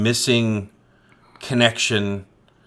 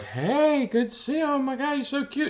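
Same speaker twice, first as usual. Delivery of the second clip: high, breathy-free mock swoon from the women; 5 dB quieter than usual. Women in town gushing over the new guy, high mock swoon. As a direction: squeal it like smitten girls greeting the new guy. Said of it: "all the women are like".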